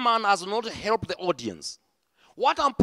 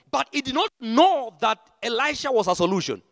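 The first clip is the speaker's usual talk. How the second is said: usual speaking voice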